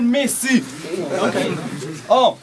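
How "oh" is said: usual speaking voice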